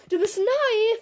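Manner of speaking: höher und lauter, jubelnd; frohlockend. A narrator's reading. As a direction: begeistert ausrufen wie eine überdrehte Lehrerin. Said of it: "frohlockte sie". (Misses P begrüßt Nadima frohlockend)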